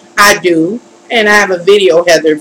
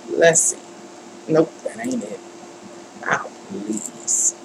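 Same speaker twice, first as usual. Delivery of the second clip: whispered plea under the breath; very soft, voiceless pleading; exasperated whisper; 2 semitones lower, 12 dB quieter than usual. Real, not acted. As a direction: whisper it to the stubborn screen. Pleading under breath with stubborn software.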